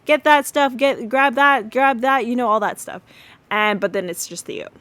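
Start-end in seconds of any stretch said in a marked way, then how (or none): 0.1-2.2 higher and louder mock kitchen yelling; retold yelling. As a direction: bark out frantic orders in jest. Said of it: "start yelling and saying".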